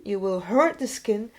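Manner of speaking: ordinary talk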